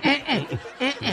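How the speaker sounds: high laugh. Real, not acted. Laughs at joke.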